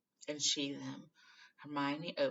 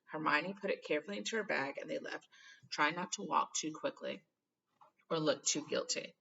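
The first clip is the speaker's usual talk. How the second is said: plain reading voice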